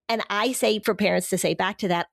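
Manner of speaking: ordinary talk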